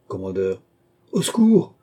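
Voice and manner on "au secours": high, loud cry for help; panicked shout for help, much higher and louder. A narrator's reading. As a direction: scream it as he is being strangled. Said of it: "cria celui-ci".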